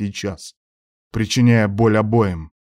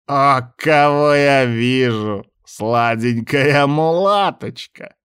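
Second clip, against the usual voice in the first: slow, higher sleazy drawl, leering; lewd leer; 5 semitones higher, 4 dB louder, much slower than usual. Acted. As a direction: savour each word like a lecher. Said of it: "похабно ухмыльнулся Череп".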